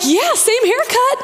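amazed onlooker. Retold or imitated. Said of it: "They're like".

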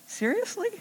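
incredulous. Real, not acted. Doubting own claim of contentment.

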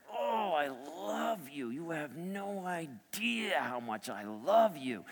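very soft, drawn-out murmur of love; hushed loving whisper. Retold or imitated. Father to his sleeping baby daughter, hushed adoring whisper. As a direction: gush slowly and softly over a sleeping child. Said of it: "but I'm whispering to her".

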